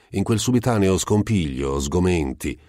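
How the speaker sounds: usual speaking voice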